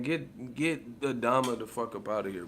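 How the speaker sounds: ordinary talk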